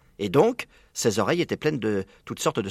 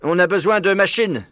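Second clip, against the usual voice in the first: high, forceful character voice; commanding farmer; 8 semitones higher, 4 dB louder than usual. A narrator's reading. Bean selling his machine plan, high insistent voice.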